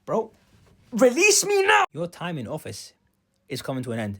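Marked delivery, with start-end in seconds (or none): 0.0-1.8 loud, high-pitched demand; acted yell to be let go; demanding shout. Demanding release from a handshake.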